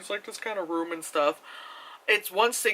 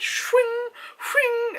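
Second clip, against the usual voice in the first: mock swing noise. Imitating sword swings.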